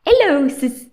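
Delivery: "Hello, sis-" high and loud, cut off mid-word; Cockney impression, broken off; abandoned Cockney attempt. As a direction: start the twin's accent, then give up.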